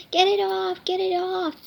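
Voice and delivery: high-pitched mock panic; alarmed cry. A narrator's reading. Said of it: "Hugo screams".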